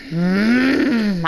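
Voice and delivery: mock angry humming; fuming hum. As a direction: seethe through a drawn-out hum. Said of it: "I was fuming in my chair".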